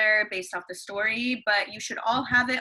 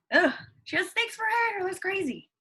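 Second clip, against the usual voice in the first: much higher, hammed-up grossed-out reaction; mock disgust; 10 semitones higher than usual. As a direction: play up being grossed out for the kids. Teacher reacts to Medusa picture.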